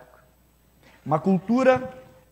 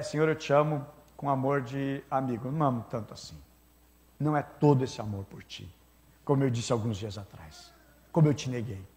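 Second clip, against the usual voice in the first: lower, softer, sinking confession; broken Peter; 5 semitones lower, 6 dB quieter than usual. Acted. Peter, ashamed of denying Jesus, answers in a lower, softer voice. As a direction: let him sound ashamed and small. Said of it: "porque ele tá quebrado".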